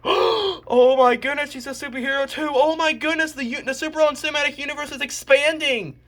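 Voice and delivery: high, gushing fake astonishment; mock amazement. Revealing that the sister is a superhero too, mock amazement.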